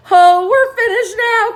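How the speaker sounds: high, loud wail; despairing cry. A narrator's reading. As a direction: cry out that all is lost. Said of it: "cried Miss Spider".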